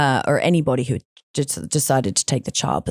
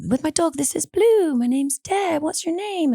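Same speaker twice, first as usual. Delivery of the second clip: put-on retelling how she calmed the crying girl, higher and gentle; calm with a lost child; 11 semitones higher than usual. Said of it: "you know, calm".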